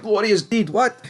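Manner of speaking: high-pitched, half-joking disbelief at the twist; mock shock. Real, not acted. Recapping a show to viewers, playfully shocked at the death. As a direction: gasp it out like you can't believe it.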